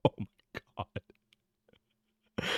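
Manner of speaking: real whispered dismay; whispered oh my god. Real, not acted. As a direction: breathe it out in stunned disbelief. Stunned realisation about his family.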